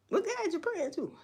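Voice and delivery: high, mocking squeak of disbelief; mock the question, voice jumping up; incredulous challenge. Real, not acted.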